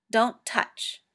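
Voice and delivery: a sharp warning; sharp don't-touch warning. A narrator's reading. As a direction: snap it out to stop him. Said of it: "said John sharply".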